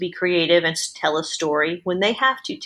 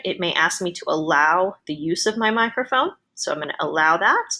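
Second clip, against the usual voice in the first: ordinary delivery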